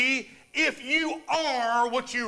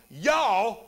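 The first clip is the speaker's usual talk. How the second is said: southern y'all; 2 semitones lower than usual. Retold or imitated. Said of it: "But he was saying".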